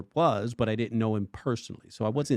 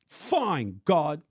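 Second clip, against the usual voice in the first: sulky surrender; 8 semitones higher than usual. Retold or imitated. Sulky retold surrender to God.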